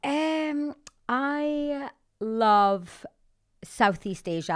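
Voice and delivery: high, loud burst of love for a place; light up, gushing to the host about travel; excited gush. Real, not acted. Host asks about Dubai, she gushes excitedly.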